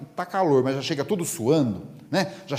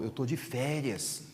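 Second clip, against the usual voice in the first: softer, slow and unbothered; lazy vacation voice; 8 dB quieter than usual. Acted. Friend telling Divaldo he is on vacation, soft and slow. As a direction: let it drop softly, no worries at all. Friend shrugging off his chores.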